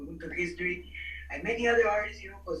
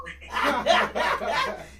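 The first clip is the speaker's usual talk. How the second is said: nervous laugh; 5 semitones higher, 7 dB louder than usual. Real, not acted.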